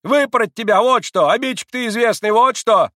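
high, loud angry market-woman scolding; scolding outcry. Acted. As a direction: tear into the boy at full voice. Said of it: "раскричалась Марья".

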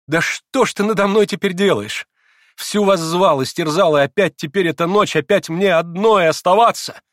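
high, loud distressed pleading; agitated, wounded outcry; anguished reproach. A narrator's reading. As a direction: plead bitterly against being left alone. Grushenka pleads with departing Alyosha.

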